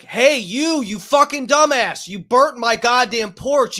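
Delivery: high, loud furious yelling; screaming at him. Retold or imitated. Homeowner screaming at the arsonist about his porch, furious yell. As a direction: tear into him for burning the porch. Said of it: "I'm screaming at this guy".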